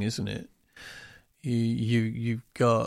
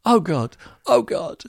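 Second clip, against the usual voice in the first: high, loud oh God; hammed-up overwhelm; mock despair; 10 semitones higher, 7 dB louder than usual. Homeowner swamped by choices, hammed-up loud despair. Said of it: "And you're going".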